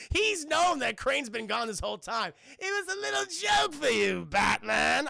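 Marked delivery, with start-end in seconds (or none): 2.6-5.1 high, loud manic villain with a theatrical taunt; Joker impression. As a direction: tease Batman like a gleeful madman, savouring every word. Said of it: "a really good Hamill Joker".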